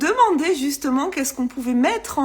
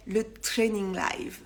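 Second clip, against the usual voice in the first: mock English accent; 3 semitones lower, 6 dB quieter, slower than usual. Shown as a demonstration. Announcing her live title to followers, in a mock English accent. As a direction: say training live slowly with a hammed-up English accent. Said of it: "avec mon super accent anglais".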